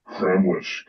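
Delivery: usual speaking voice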